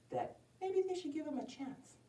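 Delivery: soft, high near-whisper; hushed conferring. A narrator's reading. Girl and hunter decide about the wolf, hushed conferring. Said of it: "they whisper a little bit".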